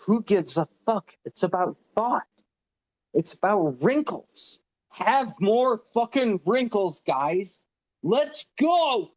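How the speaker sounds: high-pitched, fired-up rallying yell; impassioned yelling. Real, not acted. Panelist rallying the guys on the spaces, yelling. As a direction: yell it high like rousing a crowd. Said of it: "Sorry for yelling on the spaces".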